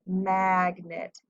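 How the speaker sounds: "magnet" with the short "a" of cat drawn out; short vowel. Shown as a demonstration. Teacher showing the short vowel in "magnet", first vowel emphasized. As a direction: lean on "ma-a-g" in "magnet". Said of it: "with a short vowel".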